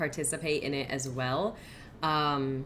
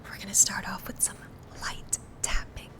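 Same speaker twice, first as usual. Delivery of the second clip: whispering. Real, not acted. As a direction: whisper gently for the ASMR stream. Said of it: "so I have to whisper now".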